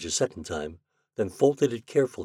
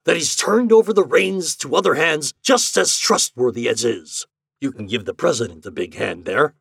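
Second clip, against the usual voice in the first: gleeful scheming; 9 semitones higher, 8 dB louder than usual. Acted. Hart grinning, spinning propaganda.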